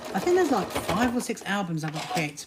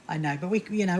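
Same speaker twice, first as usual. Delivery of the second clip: normal talking voice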